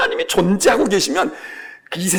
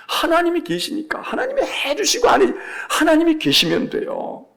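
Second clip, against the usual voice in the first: fervent rising emphasis; 8 semitones higher than usual. Acted. Preacher rising in fervour.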